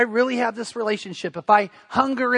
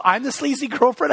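higher-pitched questioning echo of the wife; incredulous retold question, pitched up; mock disbelief; 5 semitones higher than usual. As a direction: imitate her taken-aback question. Retells wife's disbelieving question.

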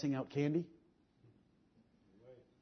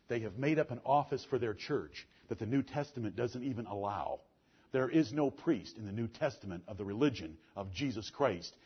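usual speaking voice

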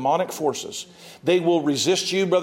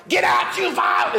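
hostile yell; 12 semitones higher than usual. Retold or imitated. Someone inside shouting at the ministers, fierce high yell. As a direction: spit it at them like a hostile spirit. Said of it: "when they're like".

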